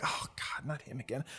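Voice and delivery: hushed groan under the breath; whispered aside. Acted. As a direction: mutter it so the regular won't hear.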